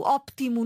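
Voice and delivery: óptimo with an audible P before the T; P pronounced. Shown as a demonstration. Showing what she does not say, P sounded in "óptimo". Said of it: "não digo o P".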